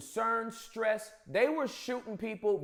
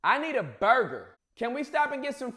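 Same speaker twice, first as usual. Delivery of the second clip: ordinary talk, nothing marked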